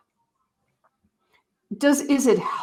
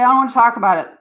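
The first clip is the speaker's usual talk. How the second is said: put-on teen refusing; 2 semitones higher, 6 dB louder, much faster than usual. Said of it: "And if they still say".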